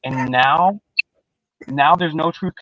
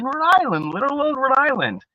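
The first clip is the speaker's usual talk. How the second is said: mock endearing; 11 semitones higher than usual. Real, not acted. Vlogger teasing about small Rhode Island, high and playful. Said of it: "mocking tone".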